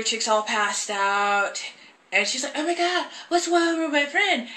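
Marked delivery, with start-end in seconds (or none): 2.6-4.5 high-pitched mock alarm; imitating alarmed girl. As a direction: play the worried friend, voice pitched way up.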